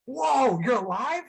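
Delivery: high-pitched astonished exclamation; mock-stunned fan surprise; amazed recognition. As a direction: react in disbelief, voice jumping up. Acts out seeing supposedly dead TV dad.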